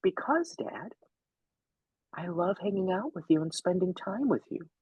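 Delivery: put-on high, simple kid's answer, stated plainly; lightly voiced son, matter-of-fact; matter-of-fact child. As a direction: give it like an earnest little boy. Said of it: "matter-of-factly, immediately says".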